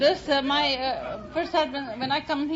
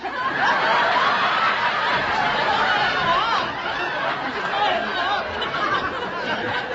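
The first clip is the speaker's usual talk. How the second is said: long, loud, high laughing fit; 7 semitones higher, 4 dB louder than usual. Real, not acted. Laughing at not knowing how to play the game. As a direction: give in to laughter.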